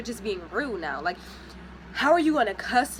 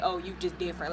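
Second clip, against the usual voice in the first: quieter, lazy and dismissive; mock fake compliment; 5 semitones lower, 7 dB quieter, faster than usual. Mocking the guy's lazy compliment, quiet and dismissive. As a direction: mouth the empty line like it means nothing. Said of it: "don't be fake".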